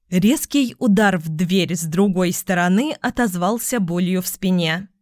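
ordinary delivery

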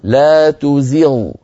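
تزغ drawn out slowly, زغ lifted slightly; demo recitation. Shown as a demonstration. Teacher advises students on Ibn al-Jazari's reading. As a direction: stretch لا تزغ, raise the غ a little. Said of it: "في مثل هذه الآية نقول".

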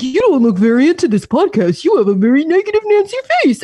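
high teasing mimic voice; mocking imitation. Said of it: "And he's like".

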